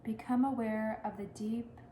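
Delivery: measured Yoga Nidra guidance with long pauses; guided meditation. Real, not acted. Yoga teacher guiding listeners into Yoga Nidra, unhurried meditation voice. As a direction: lead them into stillness, unhurried, leaving space after each phrase.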